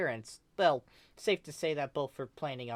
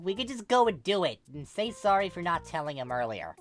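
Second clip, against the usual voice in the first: put-on grumbly girlfriend; 7 semitones higher, 3 dB louder than usual. Grumbly Aubrey pleading with Basil.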